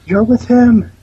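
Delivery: high, loud accusing yell; hammed-up cry of betrayal; mock screaming. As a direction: shriek it in melodramatic outrage.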